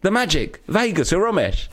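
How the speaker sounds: high, exasperated imitation of an insistent stranger; annoyed. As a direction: spell it out as if fed up. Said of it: "Like, annoyed with me".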